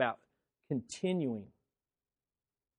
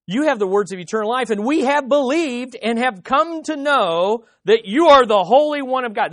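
louder, higher ringing declaration; fervent proclamation; 8 semitones higher, 12 dB louder than usual. Acted. Preacher declaring Peter's words, loud and fervent. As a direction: proclaim it like a confession of faith.

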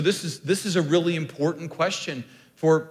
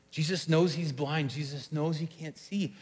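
ordinary delivery

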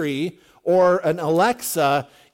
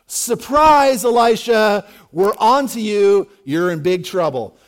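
mock ambush shout; 2 semitones higher, 6 dB louder, slower than usual. Army springs a trap on Elisha, mock triumphant shout. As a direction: call out a gleeful surprise attack, drawing out the end.

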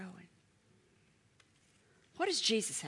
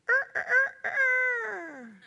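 rooster crow; 5 dB louder than usual. Retold or imitated. Preacher imitates rooster crowing.

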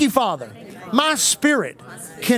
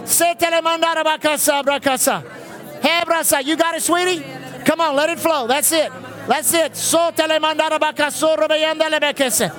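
higher, driving revival exhortation with tongues; preaching at full force; 6 semitones higher than usual. Real, not acted. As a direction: whip the church into prayer. Preacher leads loud group prayer.

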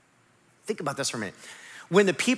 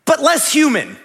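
loud longing; 7 semitones higher, 6 dB louder than usual. Retold or imitated. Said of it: "you go".

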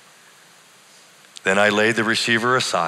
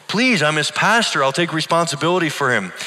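higher, urgent plea; pleading pastor; 7 semitones higher, 3 dB louder than usual. A narrator's reading. Pastor begging the nurse to let him help, higher and urgent. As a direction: beg to take charge.

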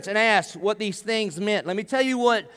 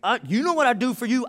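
tense, higher-pitched scolding dad, not shouted; great consternation; 4 semitones higher than usual. Pretend. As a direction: act out an exasperated dad ordering his kid inside. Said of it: "I would go out with great consternation".

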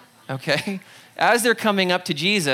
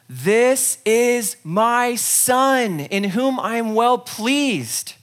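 slow, majestic proclamation; voice from heaven; 4 semitones higher, 4 dB louder, much slower than usual. Acted. God speaking from heaven over Jesus, slow and majestic. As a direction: announce it from above.

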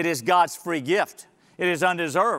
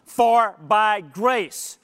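slow, deliberate three words; preacher opens the first phrase very slowly; much slower than usual. Shown as a demonstration. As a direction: let each word land alone. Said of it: "The first one".

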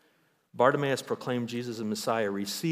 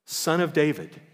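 raised, louder call to Jesus; crying out; 5 semitones higher, 4 dB louder, slower than usual. Retold or imitated. Blind beggar calls out to Jesus, raised loud cry. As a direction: call out to a passing crowd. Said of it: "he began to cry out and say".

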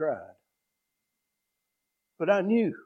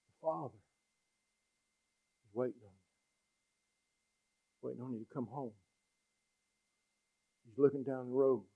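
tender invitation; 3 semitones lower, 8 dB quieter than usual. Acted. Preacher softly calling wanderers back to the Father. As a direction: invite them home quietly.

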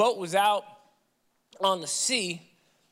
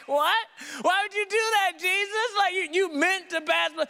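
incredulous shout; 8 semitones higher than usual. Retold or imitated. Preacher acting a disciple yelling at Jesus in disbelief. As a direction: shout it, half laughing, in disbelief. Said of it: "I'm like".

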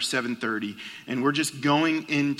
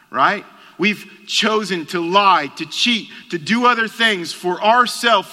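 louder, higher preaching at full force; 6 semitones higher, 7 dB louder than usual. Real, not acted. Preacher confronts the church with its sin, at full force. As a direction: drive the point home to the congregation.

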